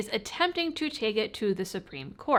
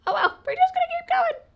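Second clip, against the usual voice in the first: mock wail; 6 dB louder, faster than usual. Comic resignation after whispered regret.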